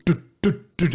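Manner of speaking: high, loud beat noise; mock dut dut dut of a limp drop; imitated bass drop. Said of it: "and then it was just".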